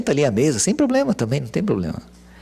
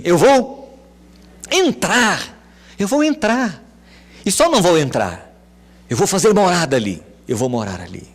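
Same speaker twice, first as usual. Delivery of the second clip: high, loud proclaiming; preaching Jesus' promise; 8 semitones higher, 4 dB louder than usual. Acted. Jesus promising to enter the house, proclaimed. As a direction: proclaim it with fervour.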